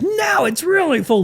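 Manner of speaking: very high, mock-amazed "Now it's really full"; squeaky high student voice. College students answer the teacher, squeaky high. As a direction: pitch "really" way up like a wide-eyed student.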